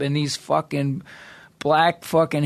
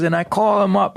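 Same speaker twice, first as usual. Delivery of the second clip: ordinary delivery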